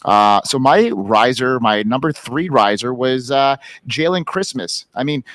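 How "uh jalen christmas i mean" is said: normal talking voice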